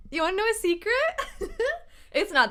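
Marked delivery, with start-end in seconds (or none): 0.1-0.9 playful. Real, not acted. Teasing viewers with a secret. 1.0-2.1 bright high giggle. Real, not acted. Laughs after teasing a secret.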